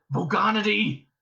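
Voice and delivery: urgent prompting hiss, still voiced; hissed correction. A narrator's reading. Ford corrects Arthur's slip, hissing. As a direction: correct him in an urgent aside. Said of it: "Ford hissed at him".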